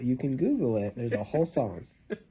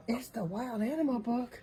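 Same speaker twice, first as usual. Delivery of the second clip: excited gasp; 10 semitones higher, 9 dB quieter than usual. Real, not acted. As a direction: gasp like it's a big surprise. Reading to baby, gasp.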